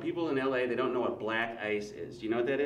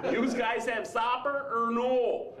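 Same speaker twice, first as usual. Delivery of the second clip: put-on high, very drawn-out Wisconsin accent; mock Wisconsin buddy, dragging "or no"; hammed-up Wisconsin drawl, pitched high; broad Wisconsin accent; 9 semitones higher, 3 dB louder, much slower than usual. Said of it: "what I call the er no factor".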